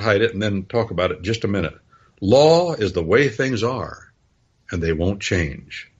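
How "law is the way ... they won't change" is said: each word laid down slowly; solemn slow definition; slow, weighty definition. Shown as a demonstration. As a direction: define it slowly like carving stone. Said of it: "that's one, one way to say it".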